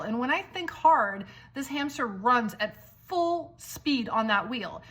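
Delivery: ordinary talk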